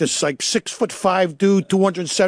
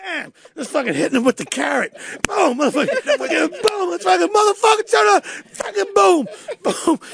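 excited; 11 semitones higher than usual. Retold or imitated. Bunny beating the suspect.

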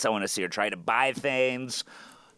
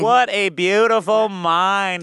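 drawn out, louder mock wonder; slow mock awe; 5 semitones higher, 6 dB louder, much slower than usual. Real, not acted. Host teases the guest about the math: slow, mock awe. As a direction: savor it, slowly, in fake awe.

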